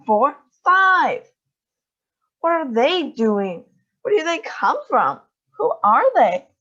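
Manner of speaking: high, loud overlapping shouts; shouting. A narrator's reading. The crowd at the river, shouting excitedly. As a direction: shout over each other in wonder. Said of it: "everyone started shouting at once".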